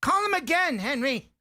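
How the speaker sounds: put-on excited old customer. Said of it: "they're like".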